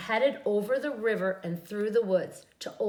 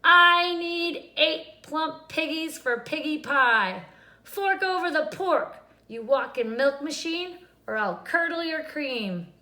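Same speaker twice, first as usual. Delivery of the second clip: angry witch; 6 semitones higher, 5 dB louder than usual. A narrator's reading. Witch threatens cow.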